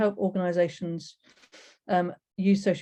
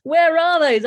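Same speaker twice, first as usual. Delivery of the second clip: much higher and louder, craving; real thrilled food craving; eager excitement; 8 semitones higher, 10 dB louder, slower than usual. Real, not acted. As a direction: demand to know where the treats are. Presenter sees cream-egg donuts.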